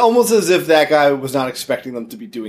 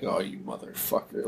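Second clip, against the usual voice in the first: mock muttered curse; 8 semitones lower, 16 dB quieter than usual. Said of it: "And he's like".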